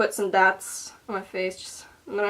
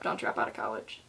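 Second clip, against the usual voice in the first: whispered aside; 11 semitones lower, 10 dB quieter than usual. Pretend. Mock advice aside.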